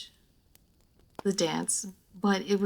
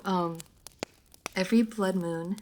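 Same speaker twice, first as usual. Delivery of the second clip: ordinary talk, nothing marked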